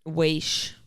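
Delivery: "Wash" said as waysh; imitated mispronunciation. Listener echoing the weird accent, "Wash" as waysh. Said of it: "he had a weird accent".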